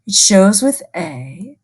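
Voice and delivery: ordinary talk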